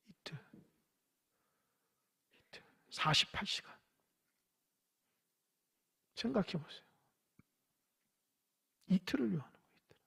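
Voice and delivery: breathy, dramatic whisper; hushed whisper. Real, not acted. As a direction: let each word hang in a hush. Dramatic hushed emphasis on delay. (숨죽여 속삭이듯)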